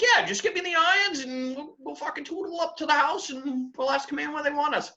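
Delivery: high-pitched mock reply; retold crew voice. Crew member answering the officer, high put-on voice.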